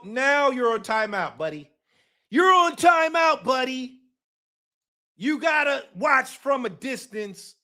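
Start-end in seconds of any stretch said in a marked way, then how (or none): none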